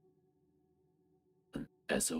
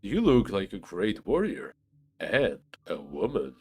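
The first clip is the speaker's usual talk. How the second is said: loud; 8 dB louder than usual. Acted. Flattering a warrior NPC.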